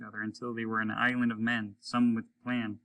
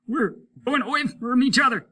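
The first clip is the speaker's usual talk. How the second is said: high, loud desperate cry; frightened voice calling across space; panicked cry; 14 semitones higher, 9 dB louder than usual. Acted. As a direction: shout it in terror into the void. Voices crying out in fall.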